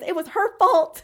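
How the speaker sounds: high, loud mock blame; mock accusing. Elsie pointing at the other woman to blame her, mock accusing. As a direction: loudly pass the blame, half laughing. Retold character blaming Aurora.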